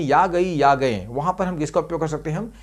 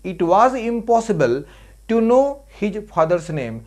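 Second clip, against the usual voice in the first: slow dictation; 3 semitones higher, 3 dB louder, much slower than usual. Shown as a demonstration. Teacher dictating an English example sentence.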